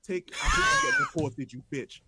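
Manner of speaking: loud, high jeering yell at the rival fan; shouting at a rival fan in jest, loud jeering yell; mock angry yell. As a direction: yell it like a taunt from the stands. Yelling insult at rival fan.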